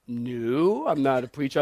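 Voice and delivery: low, soft, puzzled denial; guarded reply dropped well down in pitch; wary denial. Retold or imitated. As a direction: answer low and soft, taken aback. His wary reply, acted out.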